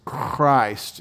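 Christ with a long throaty rasped ch; back-of-throat ch. Shown as a demonstration. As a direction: drag out a scraping kh at the start of Christ. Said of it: "it's in your back of your throat".

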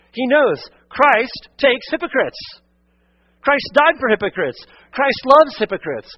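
loud, high, urgent pleading preacher; preaching at full force. Acted. Pastor urging the congregation to come to Christ, fervent preaching. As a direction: call the whole room to come forward now. Impassioned altar call.

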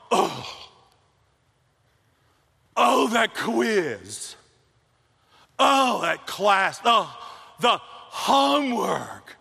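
slow, drawn-out whining; mock whiny complainer. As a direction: whine about school slowly. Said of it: "Every time I got with her".

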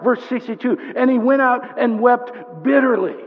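high, loud, insistent sermon voice; impassioned preaching. Real, not acted. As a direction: press every phrase on the congregation. Preaches at full force.

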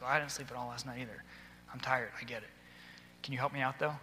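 soft, low, sympathetic; quiet sympathy. Retold or imitated. Teacher telling a tired pupil he understands, softly. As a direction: tell him you're tired too, gently. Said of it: "I was like".

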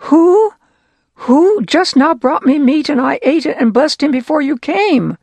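high, loud frantic questioning; shaken and alarmed. Acted. Blind father realising he was tricked, frantic and loud. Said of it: "Isaac started shaking him and said".

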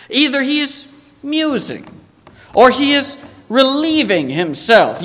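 mocking taunt. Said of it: "Elijah mocked them".

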